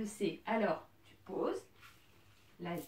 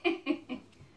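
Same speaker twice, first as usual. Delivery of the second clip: light amused chuckle; short laugh; 4 semitones higher than usual. Real, not acted. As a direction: laugh it off warmly. Laughing at student's wobble.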